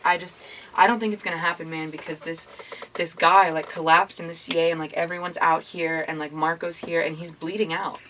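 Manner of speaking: hushed retelling of bad news over the phone; lightly mimicked quiet, troubled friend; quiet, shaky caller. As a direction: imitate a subdued caller, low and soft. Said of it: "He's, like, kinda quiet and, like".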